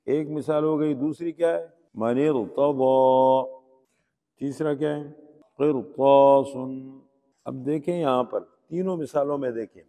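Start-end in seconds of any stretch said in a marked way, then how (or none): 1.9-3.6 من ارتضی recited loud and slow, full r; thick r in من ارتضی, long final vowel; heavy r. Shown as a demonstration. Teacher gives second exception, heavy r recitation. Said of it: "موٹا پڑھتے ہیں". 5.6-6.6 قرطاس recited loud with thick r and long aa; heavy r. Shown as a demonstration. Teacher gives third exception, heavy r recitation. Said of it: "موٹا پڑھتے ہیں".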